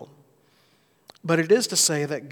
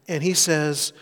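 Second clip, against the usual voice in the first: ordinary talk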